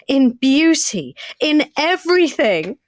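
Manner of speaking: slow, rising lovestruck gush; rapturous diary reading. Acted.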